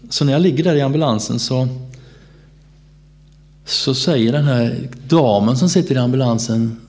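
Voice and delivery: usual speaking voice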